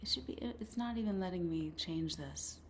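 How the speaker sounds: faint, far from the mic; muttering softly while fiddling with settings; barely audible. Real, not acted. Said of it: "very gentle".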